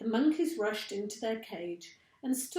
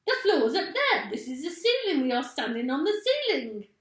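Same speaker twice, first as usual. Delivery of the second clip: high, loud panicky cries; frantic exclaiming; 7 semitones higher, 8 dB louder than usual. A narrator's reading. Missus Twit tells her husband they stand on the ceiling, high frantic cries. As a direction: panic at what she sees above. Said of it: "screamed Missus Twit".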